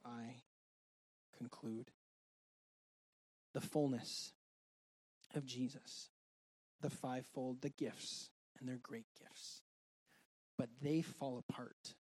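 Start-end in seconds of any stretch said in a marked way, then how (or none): none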